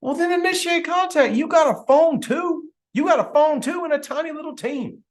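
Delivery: high, incredulous scolding; high-pitched exasperated comeback; exasperated retort. Acted. Acted exasperated retort to complainer.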